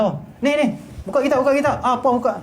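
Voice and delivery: high, impatient mock scolding; harsh ordering. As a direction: order students about crossly, as a demonstration. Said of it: "Tak suka". (contoh arahan secara marah)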